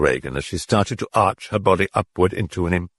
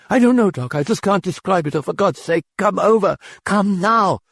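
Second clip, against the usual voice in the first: high, panicked plea on the phone; frantic mother begging the doctor to come; on the brink of hysteria; distraught, near hysteria; 11 semitones higher than usual. Acted. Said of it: "Her voice was distraught and on the brim of hysteria".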